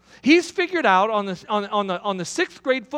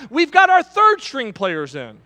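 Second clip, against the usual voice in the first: loud, slow shout back across the field; coach yelling back; 5 semitones higher, 7 dB louder than usual. Retold or imitated. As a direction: bellow it back at the other team. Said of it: "our coach is yelling back".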